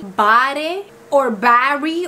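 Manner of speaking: BARE said "BAH-reh", then "BARRY"; spelling-based mispronunciation. Shown as a demonstration. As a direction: sound the final E of BARE as its own syllable. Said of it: "wouldn't it be".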